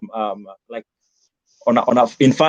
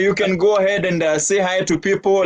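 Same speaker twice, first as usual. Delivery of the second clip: ordinary delivery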